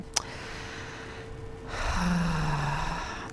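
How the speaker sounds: long tired sigh; weary sigh. Real, not acted. Distribution is a long process.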